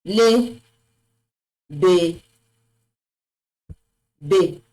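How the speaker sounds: 'be' said 'bay', syllables set apart; acute-accent pronunciation. Shown as a demonstration. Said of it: "this particular, uh, accent is E".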